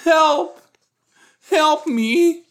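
high, loud frantic cry for help; panicked cry for help. Pretend. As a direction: shriek for help like a drowning swimmer. Voicing a panicking swimmer.

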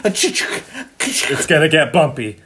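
slow, loud and high, drawn out; ominous warning. Pretend. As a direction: warn them it gets rough. Announcing the bumpy ride.

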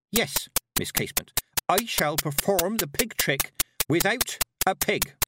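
defiant showman's proclamation; loudly. A narrator's reading. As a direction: announce it proudly, spacing out the words. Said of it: "said Petulia loudly".

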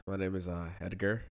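ordinary talk

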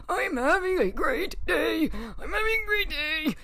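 a mock sob-shouted forced cheer; breaking into a cry. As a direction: wail that you're fine while falling apart. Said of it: "That doesn't sound like it".

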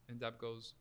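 ordinary talk

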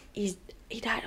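hushed, breathless whisper of bad news; retold whisper. Mom breaking the news of a death, whispered.

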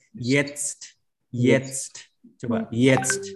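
jetzt with the t clearly sounded before the final s. Shown as a demonstration. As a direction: say jetzt giving the t its full weight. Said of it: "harusnya ada T-nya juga".

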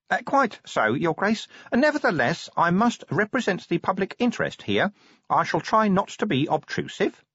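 high-pitched, careful official; prim little inspector, deferential. A narrator's reading. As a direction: stand on duty in a high, polite voice. Inspector A E Pessimal's character voice.

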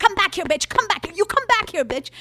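high, shrill-free mock scream of a chaser; imitating angry yelling. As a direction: yell after someone fleeing, voice climbing high. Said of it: "They're like".

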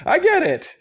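disciples getting it. Pretend.

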